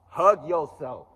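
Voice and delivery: drawn-out emphatic "Hug yourself"; loud, slow, high urging. Shown as a demonstration. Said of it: "Tell yourself you love you".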